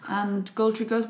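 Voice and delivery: normal talking voice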